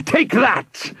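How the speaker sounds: high-pitched combative exclamation; villain's attacking cry. A narrator's reading. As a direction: cry out as you strike. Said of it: "he cried".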